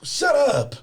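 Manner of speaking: exasperated thought, stretched slow and high; annoyed inner groan. Retold or imitated. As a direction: drag it out like you're sick of her. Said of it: "And I'm like".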